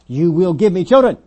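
high, loud demand; shouted, insistent order. Acted. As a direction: belt it out as an ultimatum to a spouse. Preacher acts out a wife's demand.

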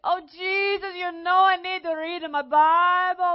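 put-on Italian accent, tearful begging. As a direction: wail to Jesus like an Italian grandmother. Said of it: "without doing the accent".

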